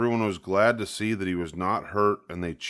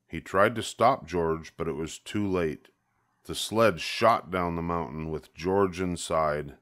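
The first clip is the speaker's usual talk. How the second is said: plain reading voice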